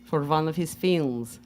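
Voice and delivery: put-on light Russian accent, broken English. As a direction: tell the story in a Russian accent. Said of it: "do a Russian accent".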